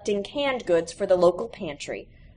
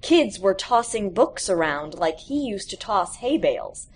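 usual speaking voice

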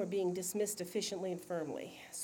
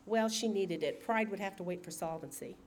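plain reading voice

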